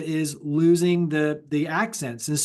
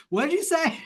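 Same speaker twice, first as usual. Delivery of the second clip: mock-puzzled question, pitched way up; baffled question; 11 semitones higher than usual. Host imitating LA friends baffled by his wife's accent, high mock-puzzled. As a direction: play the confused listener who can't follow her. Mimicking confused LA listener.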